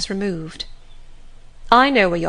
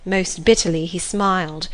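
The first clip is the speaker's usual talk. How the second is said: usual speaking voice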